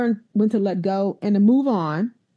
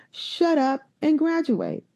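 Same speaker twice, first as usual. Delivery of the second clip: slow firm advice; 5 semitones higher, slower than usual. Retold or imitated. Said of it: "He said".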